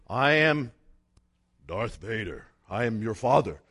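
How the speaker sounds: deep low menacing Vader voice; Darth Vader. Pretend. Preacher joking he could claim to be Vader, deep and low. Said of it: "I could say".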